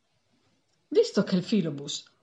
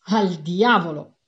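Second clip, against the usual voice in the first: loud, slower cry with each kick; shouting while kicking; 7 dB louder, slower than usual. A narrator's reading. Clerks playing football with newspapers, loud shouted curse. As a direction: bellow it as you boot the ball.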